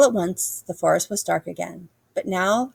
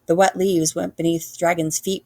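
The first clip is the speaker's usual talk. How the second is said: plain reading voice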